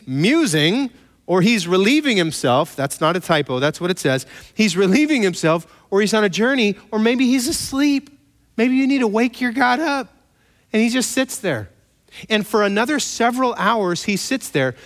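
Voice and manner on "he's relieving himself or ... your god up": mocking taunt. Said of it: "Elijah mocked them".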